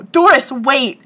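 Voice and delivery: loud, high call across the room. Retold or imitated. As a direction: call after her, desperate. Max calls from the other room.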